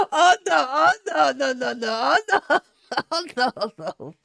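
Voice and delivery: high laughing "oh no"s. Real, not acted. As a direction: giggle through the protests. Laughing at chat comment.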